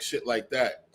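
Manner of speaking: usual speaking voice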